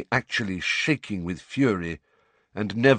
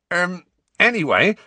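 flustered, higher and louder filler; awkward Hagrid; 10 semitones higher, 6 dB louder than usual. A narrator's reading. Hagrid fumbling to resume his lesson, flustered. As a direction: stumble to get back on track. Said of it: "clearly struggling to regain the flow".

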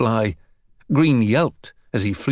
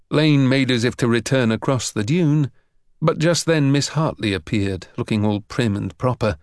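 ordinary delivery, nothing marked

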